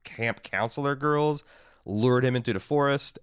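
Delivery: ordinary talk, nothing marked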